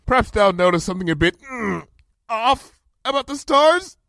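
high, cheery voice covering effort; strained joviality. A narrator's reading. Princess straining at the sky shouts up cheerily, forced joviality. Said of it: "pulling a rug of joviality over the strain in her voice".

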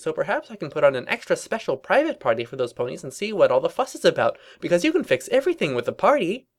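high, swooping cartoon pony voice; bubbly Pinkie Pie chatter. Pinkie recounting her party plan, high bubbly chatter. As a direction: ramble on in a high, excitable pitch.

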